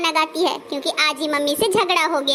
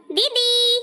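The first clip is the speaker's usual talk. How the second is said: raised, stretched call to someone elsewhere; 6 semitones higher, much slower than usual. Acted. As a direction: call out for an elder sister, higher pitch. Going off to call sister.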